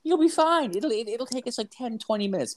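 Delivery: high, sweet mock voice of the kinesiologist. Imitating the kinesiologist reassuring him about the test. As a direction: lift your voice way up and play her all sweet and easy. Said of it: "We're gonna, like".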